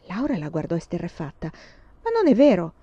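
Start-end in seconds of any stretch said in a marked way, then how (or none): 2.0-2.7 put-on shocked girl denying loudly; exclaiming. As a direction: burst out in denial. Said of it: "esclamò".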